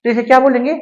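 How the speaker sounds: ordinary talk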